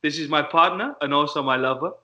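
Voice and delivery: put-on comic Dutch accent from a sketch show. Sketch policeman introduces his partner and lover, Dutch accent. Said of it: "You just nailed it".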